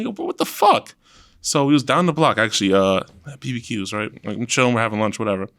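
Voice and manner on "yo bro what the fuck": very high-pitched mock exasperation; retold exasperation. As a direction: exclaim it high, in comic disbelief. Retelling exasperation at bad promoter.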